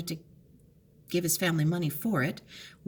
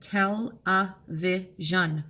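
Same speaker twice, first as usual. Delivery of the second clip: slow syllable split; much slower than usual. Shown as a demonstration. Teacher counting syllables, slow and clear. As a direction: tell a vision, drawn out syllable by syllable. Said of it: "four syllables".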